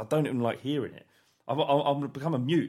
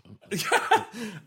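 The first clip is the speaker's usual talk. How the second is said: laugh; 9 semitones higher, 3 dB louder than usual. Real, not acted. Laughing at remark.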